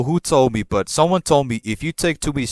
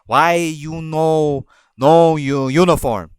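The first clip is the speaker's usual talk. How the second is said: slow, loud, broken-English scolding; imitating the boss's slow, louder scolding; mock bossy manager; 3 semitones higher, 4 dB louder, slower than usual. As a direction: drag it out like a nagging boss demanding an answer. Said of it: "she bitched at me".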